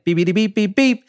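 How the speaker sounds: high beeps climbing at the end; imitated beeping. Host announcing the rapper's alien persona, imitated beeping. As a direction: mimic a rising electronic beep. Mimics transition beeps between song themes.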